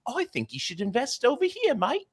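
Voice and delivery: very high mock British woman; squeaky put-on British lady cutting in; woman with British accent. Podcaster mimicking a woman interrupting a stocks commercial, high British falsetto. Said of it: "And then a woman comes in".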